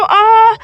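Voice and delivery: sharp loud cry of pain; short pained yelp. Acted. Ketaki in labor pain.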